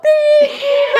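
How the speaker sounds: high imitated beeping; loud, high mock bleep; Phoebe. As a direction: beep out a censored curse, half laughing. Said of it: "where Phoebe's all".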